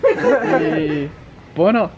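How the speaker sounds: normal talking voice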